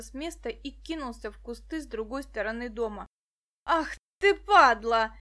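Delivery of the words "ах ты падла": furious, much louder hoarse outburst; sudden rasping roar of rage; hoarse angry shout. A narrator's reading. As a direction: bellow the curse hoarsely. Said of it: "раздался его хриплый голос".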